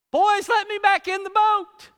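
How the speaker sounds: high, loud cheery plea; excited plea. Retold or imitated. Jonah in the sea calling the sailors, high eager plea. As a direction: call up to the boat, eager.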